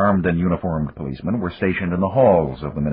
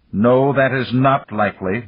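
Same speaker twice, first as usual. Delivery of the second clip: growled; 6 semitones higher, 4 dB louder than usual. A narrator's reading. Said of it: "Malik growled".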